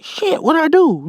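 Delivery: high panicky squeal; a kid's frightened cry, retold. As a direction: pitch way up in mock panic. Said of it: "I scream at my mom".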